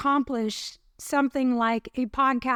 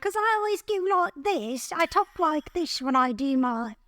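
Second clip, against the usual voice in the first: hammed-up British old lady, pitched up; British old-lady accent; 4 semitones higher than usual. Shown as a demonstration. Showing co-host her British accent, hammed-up old lady. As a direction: put on a silly old-fashioned British accent. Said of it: "English accent sounds like an".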